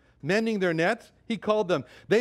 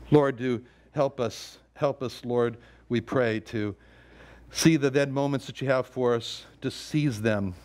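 closing prayer; 6 semitones lower than usual. Real, not acted.

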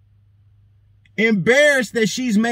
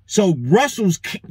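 usual speaking voice

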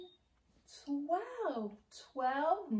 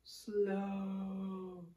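slow; 3 semitones lower, 3 dB quieter than usual. Shown as a demonstration. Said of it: "Let's do slow first".

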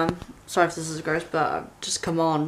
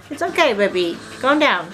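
rising higher with each it's fine; higher and higher cooing reassurance; soothing pet talk; 8 semitones higher than usual. Real, not acted. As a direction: coax the dog, climbing higher. Soothing shaking dog.

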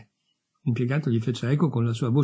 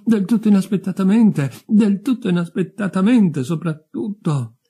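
higher, agitated adolescent voice, insistent; anxious youth; 7 semitones higher, 4 dB louder than usual. Acted. Newly dead youth recounts his illness to the others, agitated. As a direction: protest and pour out the symptoms, bewildered.

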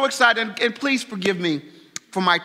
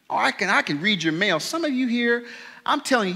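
ordinary talk, nothing marked

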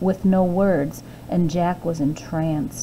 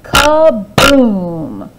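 slow, loud drawn-out kaboom; dramatic crash; 3 semitones higher, 8 dB louder than usual. A narrator's reading. Storyteller giving the giant's fall, dramatic. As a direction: stretch the big crash.

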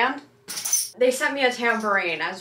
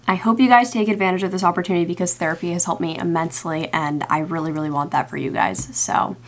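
louder, brisk upbeat ad read; sponsor ad read; 3 semitones lower, 6 dB louder than usual. Acted. Reading the BetterHelp sponsorship to her subscribers, louder upbeat ad read. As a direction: sell it like a sponsored segment.

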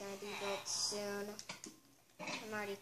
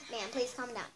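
normal talking voice

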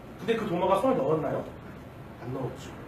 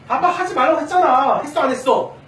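loud, high-pitched exasperated scolding; acted-out fatherly telling-off, raised voice; annoyed dad; 6 semitones higher, 8 dB louder than usual. Father scolding his child for playing ball indoors, loud and annoyed. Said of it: "짜증내겠죠".

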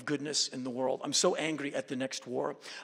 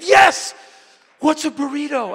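much higher, louder eager yes; 11 semitones higher, 14 dB louder than usual. Retold or imitated. Kim eagerly accepting the burrito invite, much higher and louder. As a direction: jump at the offer with delight. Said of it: "And he said".

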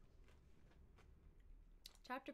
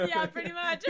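loud laughing burst; 9 semitones higher, 14 dB louder than usual. Real, not acted. Laughing at the joke.

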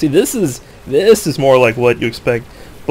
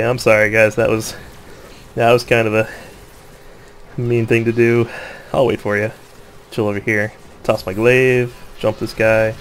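usual speaking voice

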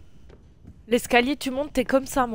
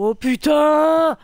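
exasperated groan; 4 semitones higher, 9 dB louder, much slower than usual. Real, not acted. Stuck on puzzle, exasperated outburst.

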